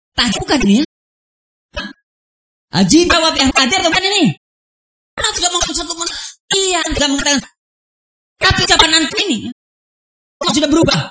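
ordinary talk, nothing marked

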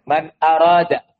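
slow, measured Arabic quotation of the Prophet; preacher quotes the Prophet's saying, slow and measured; quoted hadith. Retold or imitated. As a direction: recite the hadith deliberately, word by word. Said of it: "Nabi mengatakan".

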